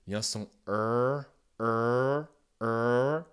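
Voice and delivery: er drawn out, the typical American r vowel. Shown as a demonstration. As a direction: hold the American er. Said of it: "typiquement américain".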